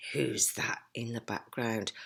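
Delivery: usual speaking voice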